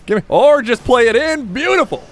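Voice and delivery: high, loud excited play reaction; thrilled, soaring cheer; delighted. Real, not acted. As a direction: let the pitch leap up in delight. Delighted as teammate scores.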